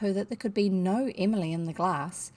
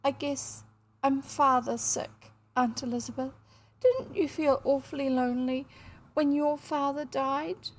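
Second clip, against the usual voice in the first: acted sobbing; 6 semitones higher than usual. A narrator's reading. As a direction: voice a grieving child reaching out to an aunt. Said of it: "sobbed Emily".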